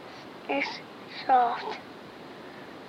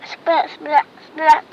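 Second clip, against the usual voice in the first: lightning splats; 8 dB louder, slower than usual. Retold or imitated. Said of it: "He makes lightning".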